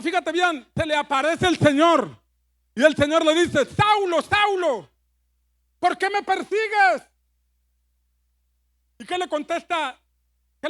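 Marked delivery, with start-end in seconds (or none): 3.6-7.0 raised, higher calling voice; voice of the Lord calling. Acted. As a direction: call out Saul's name from heaven. God calls out to Saul.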